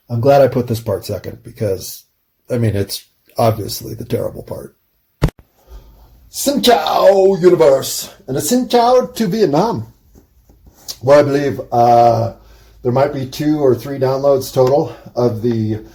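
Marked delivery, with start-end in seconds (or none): 6.3-9.9 Xin Chao said slow and high, greeting in Vietnamese style. Real, not acted. Greeting his listeners in Vietnam, slow high Xin Chao. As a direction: sing out Xin Chao to the world.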